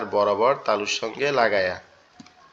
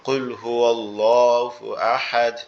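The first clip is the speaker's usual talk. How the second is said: 1 quran recitation; much slower than usual. Shown as a demonstration. Quotes Quran verses as examples.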